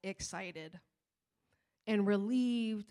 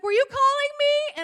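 put-on much higher and louder, a chirpy little kid; innocent little girl; 13 dB louder than usual. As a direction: play the carefree child who has no idea anyone worried. Daughter pops out, voiced innocently.

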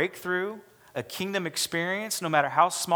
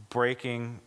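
normal talking voice

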